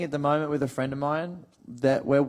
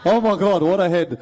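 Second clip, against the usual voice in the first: loud, higher Punchbowl-accent exclamation; 3 semitones higher, 5 dB louder than usual. Shown as a demonstration. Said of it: "they have these funny expressions".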